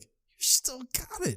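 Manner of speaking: high, soft, slow self-admiration; hushed, drawn-out smugness; smug murmur. Retold or imitated. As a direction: quietly congratulate yourself, up high. Admiring himself after girl leaves.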